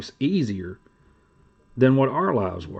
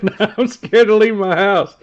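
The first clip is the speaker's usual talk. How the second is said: high, loud words pushed through laughter; cracking up while quoting him; laughing quote; 8 semitones higher, 7 dB louder than usual. Retold or imitated. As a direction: laugh your way through the line. Laughing retelling a friend's quote.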